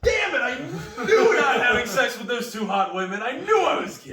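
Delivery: loud, high cry of regret; mock anguished outburst. Patient reacting to doomed joke diagnosis, mock anguished yell. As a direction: wail it in hammed-up frustration.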